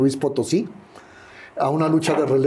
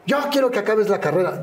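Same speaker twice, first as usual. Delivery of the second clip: high, quick imitated scolding; retold insistent father; 8 semitones higher, faster than usual. Father demanding his son finish his degree, imitated insistence. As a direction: press the demand like an exasperated parent.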